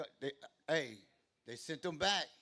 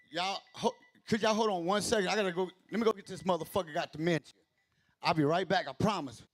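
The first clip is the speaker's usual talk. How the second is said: calling out; 4 semitones higher, 8 dB louder than usual. Real, not acted. Calls out to viewers while leaving.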